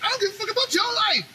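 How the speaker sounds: hammed-up high-pitched snapback; mock outraged squeal. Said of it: "so what do people do?".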